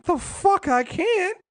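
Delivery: high, drawn-out comeback in disbelief; indignant retort. Retold or imitated. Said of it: "Freddy's like".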